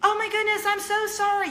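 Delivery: high, loud, gushing apology; apologetic mom upstairs. Retold or imitated. Neighbor upstairs calling down apologies, high and loud. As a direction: call down a frantic sorry from a balcony. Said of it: "we could hear her say".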